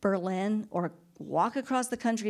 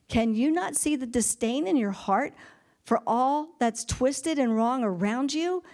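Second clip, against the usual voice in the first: raised-pitch, pressing questions; earnest remonstrance; 5 semitones higher, 3 dB louder than usual. Acted. God challenges Jonah about his disdain, earnestly pressing. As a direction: plead with him to see his own heart.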